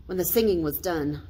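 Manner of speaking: usual speaking voice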